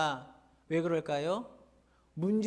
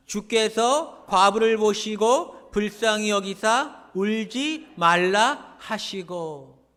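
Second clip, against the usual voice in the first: slow, loud, measured reading in unison; scripture read together; 4 semitones higher, 9 dB louder, much slower than usual. Real, not acted. Pastor leading the congregation in reading the verse, slow and loud. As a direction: read it out together, slowly and strongly. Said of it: "십삼 절 말씀 우리 합독하겠습니다".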